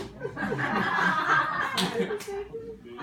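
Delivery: loud, high burst of laughter; real laughter; big laugh. Real, not acted. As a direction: crack up hard at own joke. Big laugh after impression.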